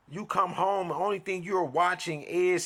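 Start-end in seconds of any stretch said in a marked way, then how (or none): none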